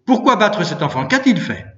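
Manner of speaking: high, loud indignant questions; crying out. A narrator's reading. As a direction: protest loudly on the child's behalf. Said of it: "criai-je bien haut".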